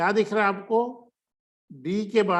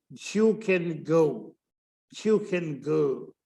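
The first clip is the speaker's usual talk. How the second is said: You can go drawn out very slowly; very slow demo; much slower than usual. Shown as a demonstration. Teacher slowly modelling You can go. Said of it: "आराम से बोलने की कोशिश करेंगे".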